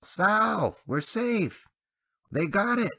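high, loud, relieved shout over the radio; full of relief. A narrator's reading. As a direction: Travis bursting with relief. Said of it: "full of relief".

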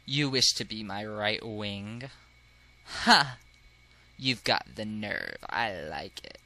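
low, slow, lofty snob; low-voiced haughty noble; haughty aristocrat. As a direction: sneer softly down at everyone in a low drawl.

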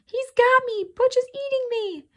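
frantic cry. A narrator's reading. Toby caught by Butch, frantic terrified shriek. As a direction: scream in terror for your life. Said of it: "Toby's cry was the most frantic".

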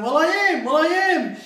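very high, loud straining protest; retelling Ivan holding the pig, very high straining protest; strained protest. Retold or imitated. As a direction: squeeze it out mid-tussle. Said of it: "Ivan going".

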